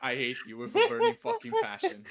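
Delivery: loud, high cursing through laughter; laughing outrage. Real, not acted. Player cursing after a bad turn, laughing outrage. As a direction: swear loudly while breaking into laughs.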